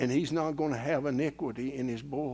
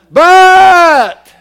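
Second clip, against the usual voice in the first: huge, high drawn-out shout; preacher's shout; 29 dB louder than usual. Acted. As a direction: belt the single word out like a pastor. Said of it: "he would just shout".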